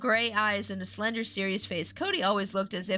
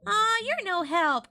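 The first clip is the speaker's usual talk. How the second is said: put-on high, drawn-out girlish complaint; mild angry scream; 11 semitones higher, 4 dB louder, slower than usual. A narrator's reading. Chris snapping at her unhelpful friend, high cross whine. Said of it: "Chris screamed angrily".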